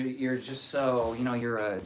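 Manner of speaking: ordinary talk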